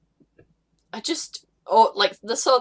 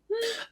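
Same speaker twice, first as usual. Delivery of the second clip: mocking high hum; 10 dB quieter than usual. Real, not acted. Pointed sarcastic hum.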